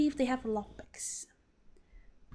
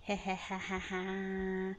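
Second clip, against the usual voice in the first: snickering; 3 semitones lower, 7 dB quieter than usual. Pretend. Sneaky villain laugh.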